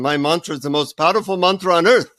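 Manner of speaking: imitated Indian guru accent. Guru boasting to the seeker about his mantra, Indian accent. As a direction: proudly proclaim it in an Indian accent. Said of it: "in his Indian accent".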